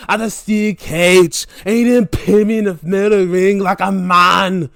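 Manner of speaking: loud mock trash talk, rival wrestler taunting. Wrestler taunting his rival, loud mocking trash talk. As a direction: bark it like a sore loser wanting a rematch. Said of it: "Deep Drew to come back and say".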